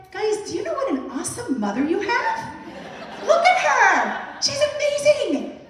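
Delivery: high, loud laughing brag, slowing on look at her; proud boasting. Retold or imitated. Mother boasting to her kids about herself, high laughing brag. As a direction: crow with delight about yourself.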